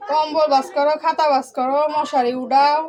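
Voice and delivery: কম্বল ভাঁজ করো said high and loud in exasperation; fed-up listing of chores. Real, not acted. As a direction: reel off the chores, sick of it. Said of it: "ভাল লাগে না".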